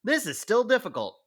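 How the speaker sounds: high-pitched imitated protest; mock complaint. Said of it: "it was like".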